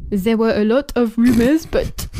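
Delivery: attempted French accent; comic French accent, not quite succeeding. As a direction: put on a rough French accent. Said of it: "tried to do a French accent".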